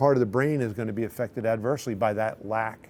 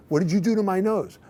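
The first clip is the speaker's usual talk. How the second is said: higher, insistent demand; retold confrontation; 9 semitones higher, 4 dB louder than usual. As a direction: demand an answer, pitch jumping up. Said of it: "she comes marching down".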